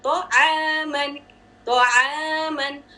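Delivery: slow तो आमन, तो heavy and आमन kept light; careful corrected recitation; correct light ain. Shown as a demonstration. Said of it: "आइन को भी heavy कर दिया".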